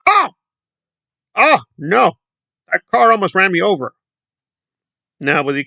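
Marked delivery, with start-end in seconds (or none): 0.1-3.9 higher and louder mock panic, Oh, no! yelped; mock startled cry. Mocking Aaron after a car nearly hits him, yelping higher and louder. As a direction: ham up a guy nearly hit by a car. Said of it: "and he was like".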